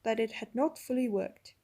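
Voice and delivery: ordinary delivery, nothing marked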